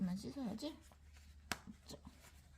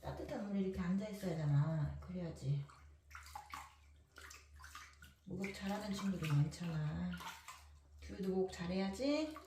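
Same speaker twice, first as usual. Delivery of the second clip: talking to a dog; much faster than usual. Real, not acted. Chatting quickly to dog.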